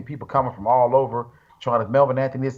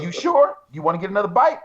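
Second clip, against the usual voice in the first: teasing coach; 6 semitones higher than usual. Retold or imitated. Chris voiced teasingly in story.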